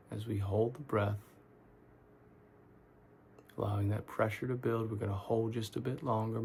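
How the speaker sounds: soft, unhurried meditation guide with long pauses; calm, quiet guiding voice; guided meditation. Real, not acted. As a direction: talk them gently through tensing and letting go, leaving space between phrases. Leads guided relaxation meditation.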